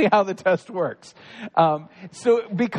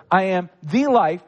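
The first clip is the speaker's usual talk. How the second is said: "the life" louder, falling firmly on "life"; stress on LIFE; 4 dB louder than usual. Shown as a demonstration. Pastor closing the point to the congregation, loud stress. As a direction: land it hard as the final claim. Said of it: "These things".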